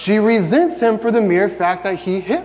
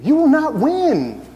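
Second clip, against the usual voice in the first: mock taunt; 10 semitones higher, slower than usual. Mock grudge-holder taunting.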